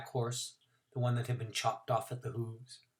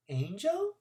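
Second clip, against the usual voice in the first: put-on awed little girl; 11 semitones higher than usual. A narrator's reading.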